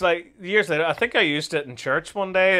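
usual speaking voice

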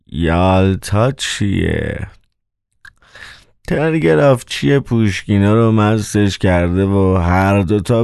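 slow, drawled manager with an aching mouth; sluggish, smacking character voice; mouth-smacking, toothache voice. A narrator's reading. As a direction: drag the words out past a sore tooth. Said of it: "صدای مدیر داخلی با ملچ و ملوچ دهان همراه شده بود".

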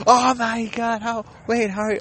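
high, flustered mock panic; panicked victim. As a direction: stumble over it in high-pitched alarm.

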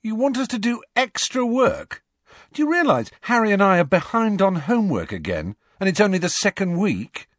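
lightly voiced indignant boy; exasperated teen boy. A narrator's reading. Ron protesting.

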